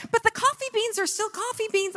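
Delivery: high exasperated complaint; incredulous. Retold or imitated. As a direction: whine it in disbelief. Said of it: "I'm like".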